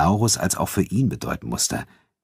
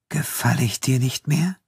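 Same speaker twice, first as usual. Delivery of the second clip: soft, higher-pitched question with an edge; understated wounded challenge; quiet sharp undertone; 8 semitones higher than usual. A narrator's reading. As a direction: ask it softly, faintly cutting. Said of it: "scharfer Unterton in ihrer Stimme".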